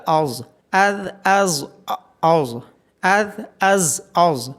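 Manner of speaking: از hard, then از heavy and drawn out; slow letter drill. Shown as a demonstration. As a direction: contrast hard ز with thick ظ slowly. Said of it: "زا کو سختی کے ساتھ از اور ظا کو موٹا".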